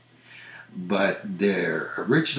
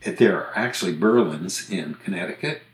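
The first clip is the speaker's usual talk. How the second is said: ordinary delivery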